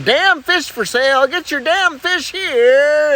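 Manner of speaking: high, slow hawker's call; street vendor call. Acted. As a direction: hawk the fish like a street seller.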